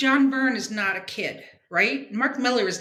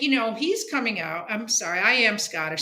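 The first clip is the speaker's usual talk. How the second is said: usual speaking voice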